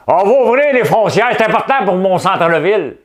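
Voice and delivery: high, loud pompous mimicry; mocking politician voice. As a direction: ham up a self-important mayor. Mocking Coderre's announcement.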